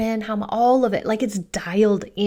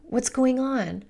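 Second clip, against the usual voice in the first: higher-pitched, gently curious parent; 4 semitones higher than usual. Retold or imitated. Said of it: "It's curious".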